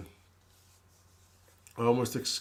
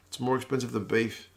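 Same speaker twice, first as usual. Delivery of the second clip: normal talking voice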